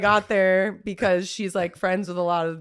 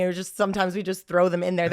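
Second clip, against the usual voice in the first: ordinary talk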